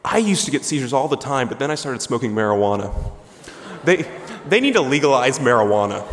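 a loud, hammed-up onlooker; loud bystander voice. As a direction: belt it out like a know-it-all in the crowd. Bystander's advice loudly voiced.

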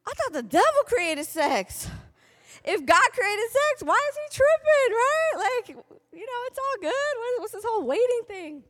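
put-on high, loud disbelieving teenager; incredulous teen. Teen girl questioning what church taught, high and incredulous. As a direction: squeal it out like a baffled sixteen-year-old.

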